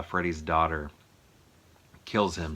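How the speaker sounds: ordinary delivery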